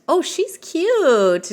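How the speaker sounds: cooing. Real, not acted. Cooing over a cute Sim.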